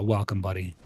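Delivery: ordinary delivery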